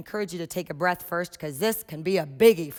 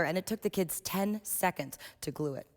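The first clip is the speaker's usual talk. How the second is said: normal talking voice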